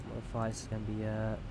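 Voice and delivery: normal talking voice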